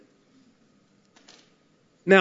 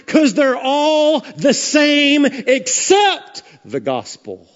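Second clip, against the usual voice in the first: higher, building pulpit preaching; fired-up preaching; 7 semitones higher, 4 dB louder than usual. Acted. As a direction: preach it with full conviction to the congregation. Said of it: "Preach, preacher".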